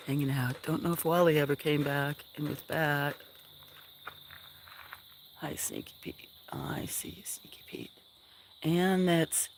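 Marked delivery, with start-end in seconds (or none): 5.4-7.9 low soft whisper. Real, not acted. Owner greets the wary cat Sneaky Pete in a low whisper. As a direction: hush so Pete stays put.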